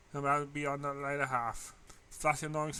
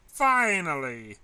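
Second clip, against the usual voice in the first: loud, drawn-out cry of relief; hollered; 3 semitones higher, 10 dB louder, much slower than usual. A narrator's reading. Rainbow-haired girl hollering at the end of class, loud and slow. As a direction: holler it as the bell rings. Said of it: "one particular rainbow-haired girl hollered".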